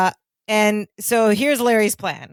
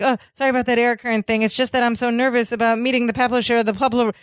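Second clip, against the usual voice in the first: fast, tripping over the words; nervous babble; 2 semitones higher, faster than usual. Retold or imitated. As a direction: rush it like a nervous wreck. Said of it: "he's like".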